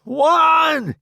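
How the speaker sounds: loud, high holler; shouted count. A narrator's reading. Roddy counting off before the charge, shouted count. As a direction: bellow the count. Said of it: "he shouts".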